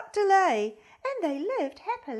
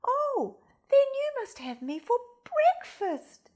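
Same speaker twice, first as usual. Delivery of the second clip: higher eager goose voice; exclaiming goose; 8 semitones higher, 3 dB louder than usual. A narrator's reading. Goose to the fox at dawn, high and eager. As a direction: goose offering herself brightly. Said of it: "exclaimed the goose".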